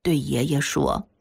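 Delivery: ordinary delivery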